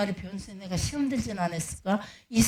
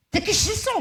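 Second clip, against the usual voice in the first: mock yell; 12 semitones higher than usual. Owner yells refusal.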